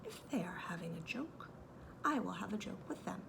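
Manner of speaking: Kanga's plan in a soft whisper; whispered aside. A narrator's reading. Kanga plotting to herself in a whisper. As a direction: whisper it as a private scheme. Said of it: "she said to herself".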